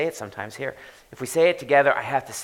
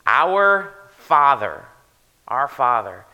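OUR FATHER loud and slow, each word weighted; emphatic repetition; 3 semitones higher, 10 dB louder, slower than usual. Shown as a demonstration. Pastor stressing the prayer's opening to the church, weighty. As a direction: lean hard on Our Father. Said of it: "dwelling on two words".

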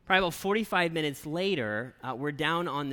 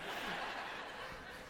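laughing; 12 dB quieter than usual. Real, not acted. Still laughing after the joke.

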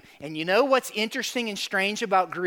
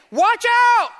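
loud, very high warning shout; forceful, high-pitched cry of warning; emphatic shout; 14 semitones higher, 7 dB louder than usual. Retold or imitated. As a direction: shout it like a sudden alarm. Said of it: "Jesus is emphatic right here".

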